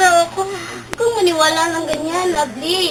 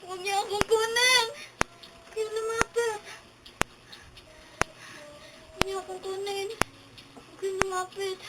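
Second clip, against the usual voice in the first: hushed pleading; 14 dB quieter than usual. Acted. Possessed girl repeats fearful pleas.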